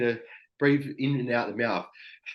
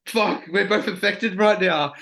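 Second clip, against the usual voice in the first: higher, louder mock alarm; retold exclamation, pitched up and loud; amused outburst; 7 semitones higher, 5 dB louder than usual. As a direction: blurt it out, tickled by the absurdity. Retold shared funny breakdown moment.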